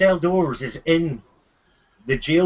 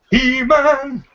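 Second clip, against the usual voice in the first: higher, drawn-out playful call; mock heroic cry; 8 semitones higher, 4 dB louder than usual. Joking with players about the captive prince, mock heroic cry. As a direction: call it out like a cartoon battle cry. Playful echo of He-Man name.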